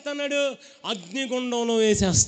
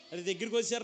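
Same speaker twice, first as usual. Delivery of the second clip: ordinary talk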